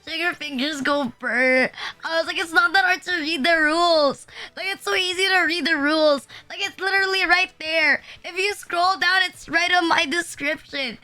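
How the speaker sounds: fed-up telling-off, played up; exasperated scolding. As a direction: scold chat in mock outrage. Acted rant at rule breakers.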